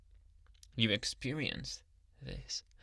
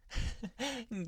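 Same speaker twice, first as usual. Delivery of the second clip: pleased creepy giggle; 10 semitones higher than usual. Acted. Laughs at patient's obedience.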